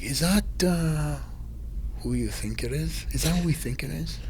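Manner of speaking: soft, hesitant mock enquiry; discreet asking. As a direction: imitate fans asking under their breath. Pool guests asking his friend discreetly.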